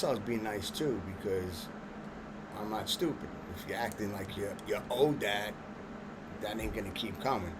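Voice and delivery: usual speaking voice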